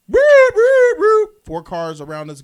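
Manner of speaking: high, loud whooping siren noise; imitated police sirens. Storyteller imitating police cars suddenly surrounding him, siren whoops.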